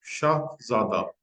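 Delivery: बादशाह और शाहजादा read out very slowly, each syllable distinct; slow model reading. Shown as a demonstration. Teacher to students, slow careful model reading. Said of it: "ये दो लफ्ज हैं".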